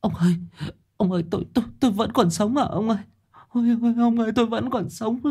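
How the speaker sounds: panting, high-pitched, stammering panic; Bà Luyến. A narrator's reading. Wife waking from nightmare to husband, breathless fright. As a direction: gasp it out high, words tumbling. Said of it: "thở từng hơi hổn hển".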